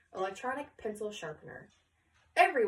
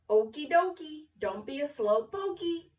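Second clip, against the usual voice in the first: jolly photographer; 4 semitones higher, 4 dB louder than usual. Acted. Photographer hurries class.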